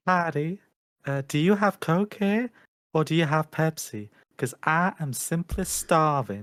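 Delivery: slow, drawn-out Southern Belle; Southern Belle accent. Shown as a demonstration. British guest trying the streamer's line, slow Southern Belle accent. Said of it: "do you wanna hear my Southern Belle".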